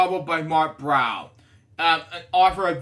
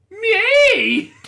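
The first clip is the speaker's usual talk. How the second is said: huge, high, squealing yell of joy; hilarious shout; 9 dB louder than usual. Pretend. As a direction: scream it out as comically as you can. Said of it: "I can't resist".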